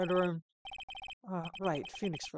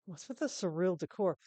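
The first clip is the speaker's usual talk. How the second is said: ordinary talk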